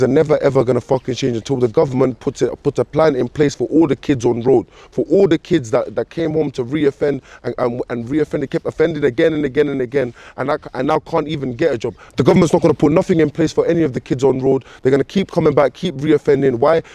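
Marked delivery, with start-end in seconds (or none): none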